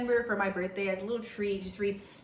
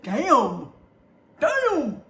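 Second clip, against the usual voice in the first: impressed; 5 semitones higher, 8 dB louder than usual. Real, not acted. Impressed by high ratings.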